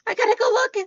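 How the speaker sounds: high, loud excited cry. Retold or imitated. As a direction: blurt it out, thrilled. Retelling rush to check message.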